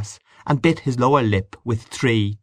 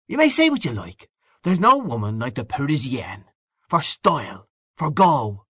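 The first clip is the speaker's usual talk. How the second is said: lively, higher-pitched Irish man of the world; hearty boastful Gallagher figure; 4 semitones higher than usual. Acted. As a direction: boast like a worldly old pal, full of relish. Gallagher praises Parisienne.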